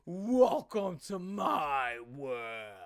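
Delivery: very slow, drawn-out, mock-sinister delivery; mocking lyric read. Mocking the song lyric, slow mock-menacing read. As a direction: stretch each word like a cheesy villain. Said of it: "That reminded me of Freddy Krueger".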